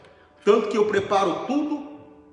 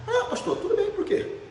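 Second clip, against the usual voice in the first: much higher, laughing widow's voice; cheerful widow. Retold or imitated. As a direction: play the widow lightly amused. (viúva risonha em voz bem aguda)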